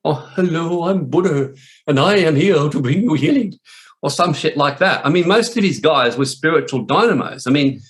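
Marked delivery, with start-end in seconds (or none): 0.0-3.6 put-on airy guru parody, raised pitch; mock a wussy, blissed-out holy man; smiling love-and-light Buddha. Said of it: "love and light wussies who'd walk around smiling".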